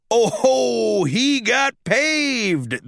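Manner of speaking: slow, gleeful ringside call; announcer shout. Acted. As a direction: stretch it out like a thrilled commentator. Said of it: "the announcer shouted".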